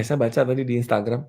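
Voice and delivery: usual speaking voice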